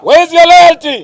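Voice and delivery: high booming rally shout; booming ideological slogans. Real, not acted. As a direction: call out to the crowd at full force. Call and response slogans.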